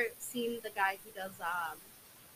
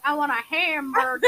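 higher, loud fake Southern American accent; mock Southern accent; 4 semitones higher, 8 dB louder than usual. Foreigner doing their best American accent, loud mock Southern. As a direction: play a foreigner's exaggerated Southern twang. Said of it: "It's always a Southern accent".

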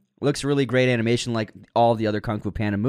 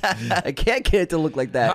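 incredulous refusal; 5 semitones higher than usual. Retold or imitated. Actor telling producers his hair won't do that, exasperated. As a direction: push back in exasperated mock disbelief. Acts out actor balking at request.

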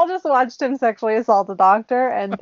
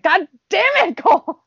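mock yell; 8 semitones higher than usual. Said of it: "could have just been like".

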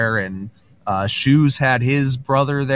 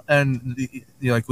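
ordinary delivery

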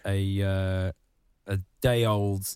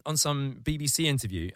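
ordinary talk, nothing marked

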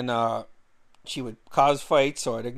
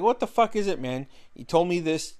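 retold exasperation, higher and louder at first; exasperated outburst; 5 semitones higher, 4 dB louder than usual. Arguing with girlfriend over shifting move-out dates, exasperated. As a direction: confront someone with raised pitch, easing off.

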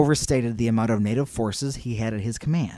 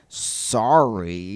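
drawn-out, lower mock-American sorry; American accent; 4 semitones lower than usual. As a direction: stretch the apology in an American accent. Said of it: "with his American accent".

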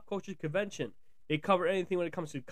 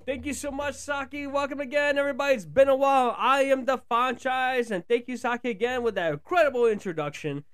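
high, loud announcer greeting; hype host intro; 7 semitones higher, 7 dB louder than usual. Acted. Host welcoming listeners back, loud high hype announcer. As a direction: pump up the crowd like a show host. Host's hyped show opening.

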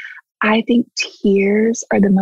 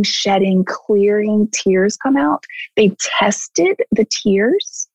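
ordinary delivery

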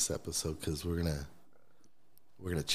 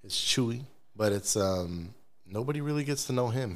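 normal talking voice

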